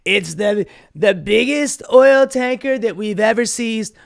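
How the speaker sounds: loud, pompous Trump voice; Trump impression. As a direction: boast like Trump at a rally. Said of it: "Trump is out there talking about, he's".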